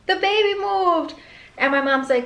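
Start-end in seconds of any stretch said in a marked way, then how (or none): 0.1-1.1 high, slow and breathless with wonder; awestruck, drawn-out delight; amazed. Retold or imitated. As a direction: gasp it out like you can't believe it. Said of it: "And I was just like".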